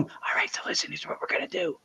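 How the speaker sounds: unvoiced imitating whisper, very soft; mock whisper. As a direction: whisper it like sneaking investigators. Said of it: "as they're walking away in the same room".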